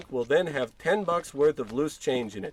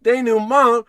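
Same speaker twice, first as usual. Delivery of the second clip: indignant disbelief; 7 semitones higher, 9 dB louder than usual. Acted. [name] mocks her word.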